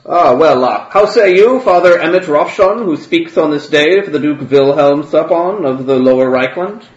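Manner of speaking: high, loud ceremonial address; formal proclamation. Acted. Grand Theologian calling Father Ropshon to vote, loud formal proclamation. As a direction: call on the elector before the whole hall.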